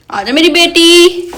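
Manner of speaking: slow, pleading sweet call; loving call. Real, not acted. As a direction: draw out each word to coax her. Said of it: "इसे प्यार से बुलाया".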